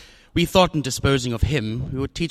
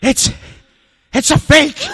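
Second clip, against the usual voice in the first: much higher and louder in dismay; shocked cry; 8 dB louder, slower than usual. Acted. Gutman discovers forgery.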